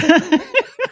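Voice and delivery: short high laugh rising. Real, not acted. Retelling the hairstylist's groan, laughing high. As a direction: giggle up high.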